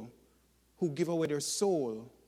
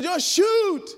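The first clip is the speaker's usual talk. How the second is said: high, very loud mock plea; hammed-up cry for help from the treetop; desperate yelling; 9 dB louder than usual. [name] to his friends below the tree, desperate mock yell.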